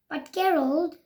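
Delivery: plain reading voice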